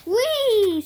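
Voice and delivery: high, loud, drawn-out whee; playful storybook whoop; gleeful whee. A narrator's reading. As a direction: cry out as if whooshing down a slide. Carmen sliding in fire drill.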